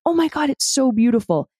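high, bright burst of wonder; delighted gasp. Retold or imitated. Hiker halfway up the mountain, delighted. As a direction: marvel at the view halfway up. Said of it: "you get up halfway and you're like".

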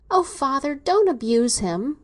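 high, pleading cry to her father; pleading exclaim. A narrator's reading. Daughter pleading with her stern father, high and urgent. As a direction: beg him to stop, voice rising. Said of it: "she exclaimed pleadingly".

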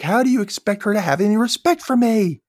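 high-pitched retold outrage; mock indignant mother. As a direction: act out an exasperated mom's scolding. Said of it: "the mom's like".

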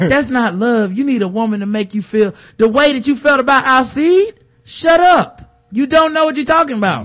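mock lecture building to a high yell; mocking Sookie. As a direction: mimic her lecturing, then snap shut up. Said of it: "She like".

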